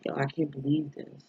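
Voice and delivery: normal talking voice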